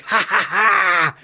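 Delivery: put-on loud, high, drawn-out baby cackle; mock infant laugh, rising and loud; imitated baby laugh. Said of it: "she's like".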